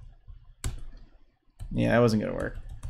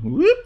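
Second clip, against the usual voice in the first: quick high rising whoop; little upward whoops, very high; playful whoop; 12 semitones higher than usual. Real, not acted. Player sounding out moves in a match, playful whoop. Vocal effect for game move.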